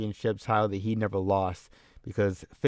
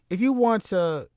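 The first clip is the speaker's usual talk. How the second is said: much higher, punchier retry of if you want to; higher promo restart; 10 semitones higher, 4 dB louder than usual. Shown as a demonstration. Retrying the promo line, pitched up high. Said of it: "I don't know".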